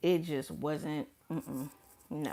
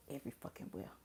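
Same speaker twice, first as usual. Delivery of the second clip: drops to a voiceless whisper; muttered whisper; 6 semitones lower, 14 dB quieter, much faster than usual. Real, not acted. Venting to viewers about the rash, she whispers the curse. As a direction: hiss the curse under your breath.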